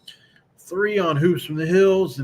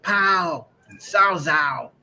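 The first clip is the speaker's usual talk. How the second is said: put-on country accent; 2 semitones higher, much slower than usual. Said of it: "The way he said".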